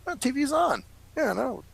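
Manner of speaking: high, light matter-of-fact reply; lightly voiced pest control guy. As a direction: point it out casually. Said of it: "He goes".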